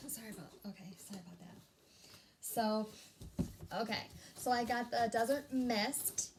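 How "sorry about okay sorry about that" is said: soft unvoiced whisper of sorry; whispered apology. Real, not acted. Vlogger apologizing to viewers for noise, whispered.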